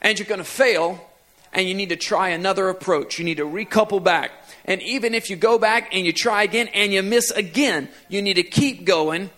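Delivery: usual speaking voice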